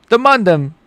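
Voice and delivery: put-on high, playful UK roadman accent. Streamer trying out British slang for viewers, UK accent. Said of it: "I just like doing the U K accent".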